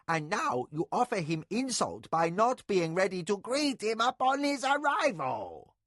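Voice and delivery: put-on sharp prim old nurse, rising; acerbic. A narrator's reading. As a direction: lecture the lady pointedly. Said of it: "recovered poise with acerbity".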